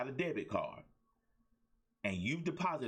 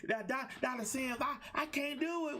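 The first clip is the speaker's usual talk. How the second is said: much higher, stammering mock-helpless voice; whiny client; 12 semitones higher than usual. Coach mocking a client who can't cope, high pleading whine.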